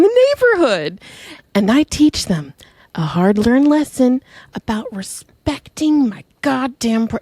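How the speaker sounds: slow, drawling grandmother in character; grandma. Acted. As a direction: sweet-talk like a grandma, swooping up on key words. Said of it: "my grandma's like".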